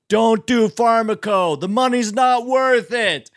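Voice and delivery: high, drawn-out burnout wail; blasted-out-of-his-mind man. Retold or imitated. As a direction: warn people slowly, like someone mentally fried. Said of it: "blasted out of his mind".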